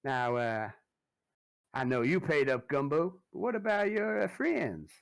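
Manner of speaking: high, loud menacing protection-racket voice; high sneering extortioner; Derek Lazenby. Acted. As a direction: lean on them with smug menace. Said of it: "Derek says".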